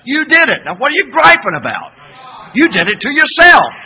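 loud, high preaching rebuke; 1 scolding shout. Real, not acted. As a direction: hammer the blame home.

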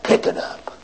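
whispered order; hushed command. Acted. Warden whispering to the prisoner to pick it up. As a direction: breathe it, no voice.

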